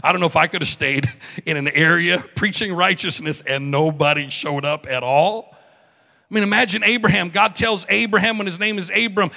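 usual speaking voice

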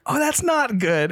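drawn-out high understatement; high, slow deadpan quote. Retold or imitated. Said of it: "He's like".

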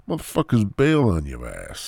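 normal talking voice

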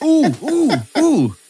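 high teasing ooh ooh ooh; mock pained ooh. Teasing friend about the bagel cut, mock pained oohs. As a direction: wince along in mock sympathy.

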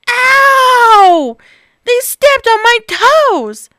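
high, loud pained squeal; squealing Baby Bear. Acted. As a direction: yelp in pain. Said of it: "squealed Baby Bear".

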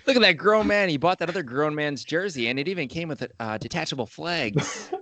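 high, fussy granny voice; old lady voice. Acted. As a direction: play a nosy old lady pointing and gossiping. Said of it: "[name]'s old lady voice cracks me up".

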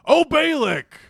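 high, loud booming call; hammed-up grand summons; mock oracle. Mocking Balaam returning from God, grandly hammed-up. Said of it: "come back and be like".

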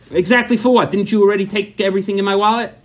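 high-pitched, exasperated interrogation; indignant. Retold or imitated. Husband grilling wife about his wallet, indignant. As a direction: grill her in mock outrage.

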